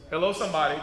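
ordinary delivery

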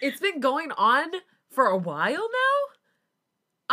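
loud, high, drawn-out disbelief; mock shock. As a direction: stretch it out in comic outrage. Listeners react in mock shock.

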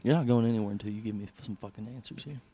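fast, menacing cop line, voice pulled back; hammed-up interrogation threat; bad cop snarling at the suspect, fast; mock bad cop.

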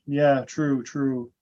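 normal talking voice

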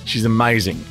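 usual speaking voice

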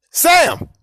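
loud sudden shout off-mic; yelling. Real, not acted. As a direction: yell across the room. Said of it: "I was yelling at the intern to play it".

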